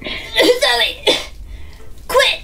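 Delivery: lightly voiced irritated teacher; mock teacher snap. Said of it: "Miss Smith is like".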